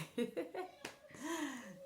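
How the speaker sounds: real amused laugh after telling a story. Real, not acted. Storyteller amused by her retold scolding, laughing. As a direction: break off into a laugh.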